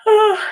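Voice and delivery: high, loud yawn slipping out into oh; a real yawn breaking through mid-sentence; big yawn. Real, not acted. Teacher yawns mid-lesson from shallow breathing, loud high yawn. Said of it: "I knew it was coming 'cause I didn't breathe".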